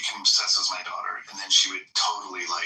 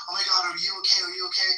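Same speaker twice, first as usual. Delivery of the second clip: mock worried mom; 9 semitones higher, faster than usual. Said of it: "My mom's like".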